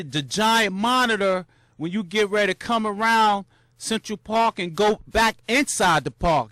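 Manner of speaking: usual speaking voice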